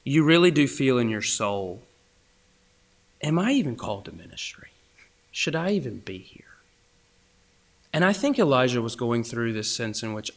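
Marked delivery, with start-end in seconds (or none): 3.2-6.5 soft, doubting inner question; hushed self-doubt. Acted. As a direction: ask it of yourself, fading toward a whisper. Voices a doubting soul.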